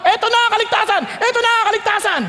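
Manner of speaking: high, insistent crowd cry; sudden shouting. Acted. Oppressed people announcing that salvation is here, shouting. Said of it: "Biglang nagsisigaw ka".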